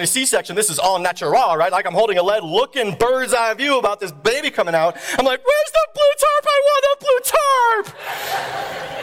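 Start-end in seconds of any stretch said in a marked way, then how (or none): 5.4-9.0 very high, drawn-out scream; panicked wail. Retold or imitated. Dad watching the birth wanting cover, panicked wail. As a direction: wail it like a desperate child.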